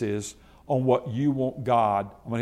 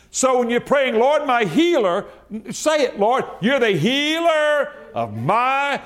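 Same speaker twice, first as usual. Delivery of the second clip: higher, louder, driving preacher's cry; full-force preaching; 9 semitones higher, 3 dB louder than usual. Acted. Pastor urging his congregation on health, preaching hard.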